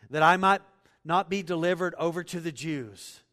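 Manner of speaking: loud, declaiming Bible reading; dramatic scripture reading. Acted. Preacher reading Pilate and Jesus to congregation, declaiming loudly. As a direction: proclaim the passage to the congregation. Reads scripture passage aloud.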